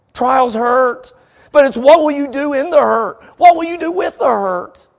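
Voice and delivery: high, loud preacher's challenge; full-force preaching. Real, not acted. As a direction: drive the question home to the congregation.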